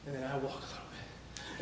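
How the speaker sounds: dropped low and soft; subdued walking take. Retold or imitated. Runner recounts slowing to a walk, low and subdued. Acts out running versus walking.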